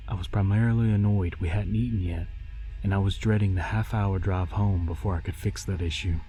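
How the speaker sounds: plain reading voice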